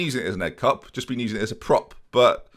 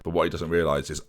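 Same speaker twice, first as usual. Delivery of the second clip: usual speaking voice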